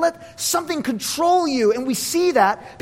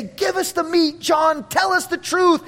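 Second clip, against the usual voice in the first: higher-pitched crowd plea; eager demand; 6 semitones higher than usual. Acted. Congregation to old John at the pulpit, eager demand. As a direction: call out keenly for substance. Congregation clamours for John's teaching.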